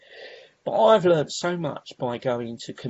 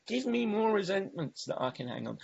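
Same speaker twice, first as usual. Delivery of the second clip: ordinary talk, nothing marked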